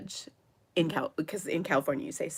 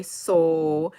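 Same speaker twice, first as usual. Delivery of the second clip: "so" with a long Minnesotan O, louder; Minnesotan accent; 6 dB louder than usual. Retold or imitated. Imitating her Southern friend's stray word, "so" in a Minnesotan accent. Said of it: "it is very Minnesotan".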